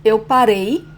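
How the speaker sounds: paREI, final syllable strong and rising; stress last syllable. Shown as a demonstration. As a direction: punch the -rei of "parei". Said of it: "it's the last syllable".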